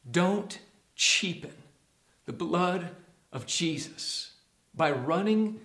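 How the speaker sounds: ordinary delivery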